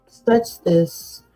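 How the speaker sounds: ordinary delivery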